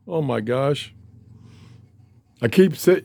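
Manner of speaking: usual speaking voice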